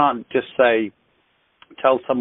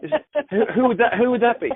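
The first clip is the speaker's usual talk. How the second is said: high, teasing pretend curiosity; playful mock surprise; 10 semitones higher than usual. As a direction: act like you can't guess, teasing. Teasing mock ignorance of friend.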